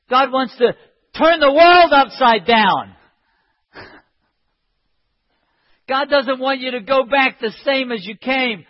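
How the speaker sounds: emphatic, driving sermon delivery; impassioned preaching. Real, not acted. Preacher urging congregation to cry out to God, impassioned. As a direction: preach it with urgent conviction.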